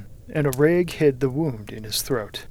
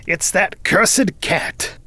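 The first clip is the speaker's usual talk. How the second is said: slow, louder, higher-pitched cursing; angry curse; 5 semitones higher, 4 dB louder, much slower than usual. A narrator's reading. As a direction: spit out the curse slowly and loudly. Wilde lying groaning after the attack.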